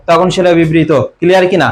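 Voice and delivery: ordinary delivery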